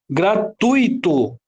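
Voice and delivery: "Gratuito" split as Gra - TUI - to; teacher's chunked "Gratuito"; syllable by syllable. Shown as a demonstration. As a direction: sound out "Gratuito" slowly, weight on TUI. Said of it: "é a última sílaba tônica".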